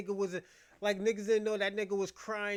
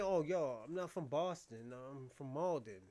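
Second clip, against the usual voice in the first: lower, softer mocking quote; mock honest confession; 6 semitones lower, 6 dB quieter than usual. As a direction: say it small, like owning up. Quoting what he should say.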